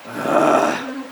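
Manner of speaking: high, strained mock groan; exasperated groan. Teacher exasperated at missed hint.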